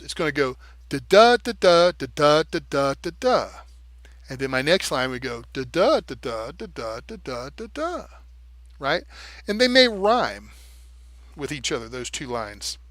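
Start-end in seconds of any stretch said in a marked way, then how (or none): 0.0-8.1 da da line chanted with every second da stressed; iambic pentameter beat. Shown as a demonstration. Demonstrating iambic pentameter to viewers, rhythmic chant. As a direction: beat out each da like a metronome. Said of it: "it's going to be five times".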